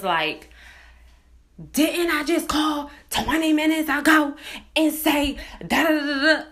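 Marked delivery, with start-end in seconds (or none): 1.7-6.4 high-pitched mock outburst of an irate woman; retold irate snapping. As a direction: imitate her blowing up, trailing into rapid filler syllables. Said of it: "how fast she went from zero to a hundred".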